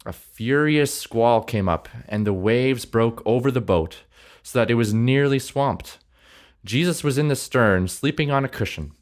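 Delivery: animated reading aloud with lifted quotes; expressive scripture reading. A narrator's reading. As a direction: read scripture like a gripping story. Reads the Bible passage aloud.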